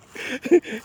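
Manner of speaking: high laughing disbelief; amused laugh through the words. Real, not acted. Laughs at own story.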